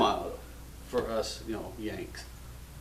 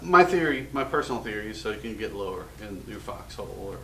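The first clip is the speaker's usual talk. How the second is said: normal talking voice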